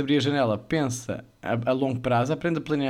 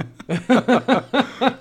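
loud laugh; 8 semitones higher, 4 dB louder than usual. Real, not acted. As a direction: burst of high laughter. Laughing at friend.

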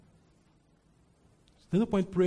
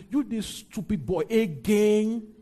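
mock condemning; 6 semitones higher, 4 dB louder, slower than usual. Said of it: "He's not gonna condemn and say".

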